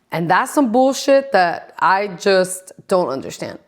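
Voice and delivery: normal talking voice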